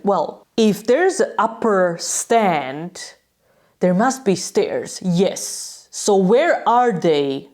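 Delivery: slow, pointed, contained irritation; retold anger. Angry fan asking steward where the stairs are, slow and pointed. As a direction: spell it out slowly, mildly angry. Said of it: "I was quite angry".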